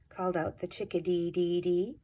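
usual speaking voice

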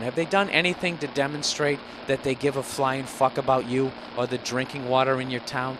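ordinary delivery

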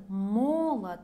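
молод stressed on the first МО, the rest light; stress on first syllable. Shown as a demonstration. Teacher contrasts the short form молод, stressed up front. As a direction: hit the МО of молод. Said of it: "on the first syllable".

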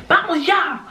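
sudden high yelled command; imitating her angry boyfriend's outburst; crazed outburst. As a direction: snap it out like he's lost it. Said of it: "Así todo loco".

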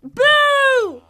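sudden loud boo to scare; startling boo. Acted. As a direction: jump out and shout boo. Jump scare on partner.

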